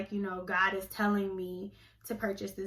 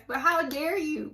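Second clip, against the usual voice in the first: higher outrage; 5 semitones higher than usual. Real, not acted. Scolding church manipulators, raised outrage. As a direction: throw the question hard. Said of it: "I'm trying not to cuss".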